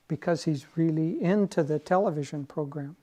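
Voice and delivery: normal talking voice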